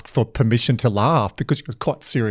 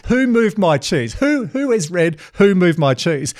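mock presenter; 6 semitones higher, slower than usual. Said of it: "You said".

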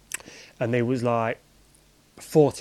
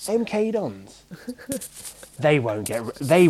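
high, drawn-out mock disbelief; retold manager's scorn; 5 semitones higher, slower than usual. Manager dismissing a rival club to players, drawn-out mock scorn. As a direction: stretch the club name out high, imitating the boss. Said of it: "and he's like".

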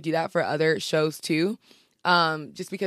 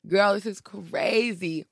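slow, drawn-out amazement; stunned, lingering retold reaction; retold disbelief; 3 semitones higher, much slower than usual. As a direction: stretch the words out in mock disbelief.

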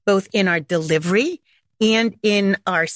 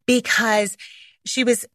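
usual speaking voice

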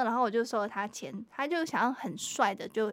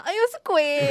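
laughing mock surprise; 6 semitones higher, 9 dB louder than usual. Clerk mocks realising customer is rude.

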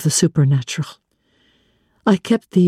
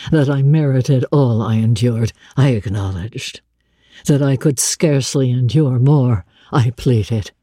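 lightly lowered, brooding man's voice; a blind master confiding his anguish; a hint of a grave older man; Rochester's voice; 7 semitones lower than usual. Rochester recounting to Jane.

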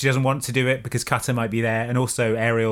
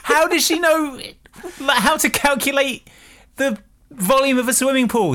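high incredulous voice; 13 semitones higher than usual. Real, not acted. Incredulous question about alien maths.

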